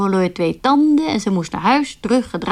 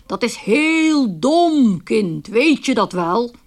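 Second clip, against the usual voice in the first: stern gentleman; much slower than usual. Retold or imitated. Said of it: "riep hij streng".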